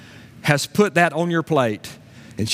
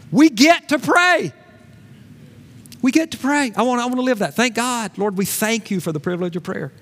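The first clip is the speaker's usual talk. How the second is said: high, forceful pulpit exhortation; rousing preacher's call; impassioned preaching; 9 semitones higher than usual. Real, not acted. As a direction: preach it to the whole church, pitched up.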